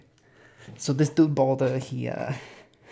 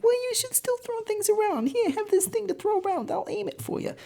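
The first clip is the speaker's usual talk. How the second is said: put-on very high, sweet old lady falsetto. Loki as old lady coaxes blind Hoder, high falsetto granny. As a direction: croon it like a kindly granny. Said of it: "still as an old lady".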